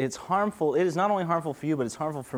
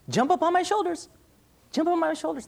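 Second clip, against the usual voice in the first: put-on high, eager voice urging a little kid; coaxing parent. Parent coaxing a child to jump, high and eager. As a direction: coax the child up, pitched high.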